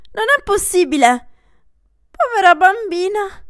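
high, loud, frantic aunt; shrill calling for help in panic; aunt shouting in alarm over the fainted girl; the aunt, panicking. Acted. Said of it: "Questa è la zia".

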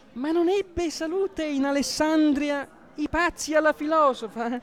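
put-on high, slow servant girl voice; Fioretta voice. Fioretta the servant answering the priest in a high voice. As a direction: pitch it up like a sharp young maid. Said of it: "una voce da Fioretta".

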